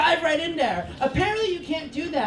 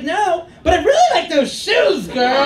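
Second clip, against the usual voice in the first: higher, louder sassy praise, laughing; sassy shout; 5 semitones higher, 7 dB louder than usual. Retold or imitated. Drunk at a strip club, praising the dancer's shoes in a loud gush. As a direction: gush over her shoes. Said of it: "I'm like".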